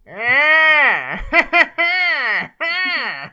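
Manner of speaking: high, loud evil cackle; evil villain laugh. Retold or imitated. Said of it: "made the evil villain laugh like".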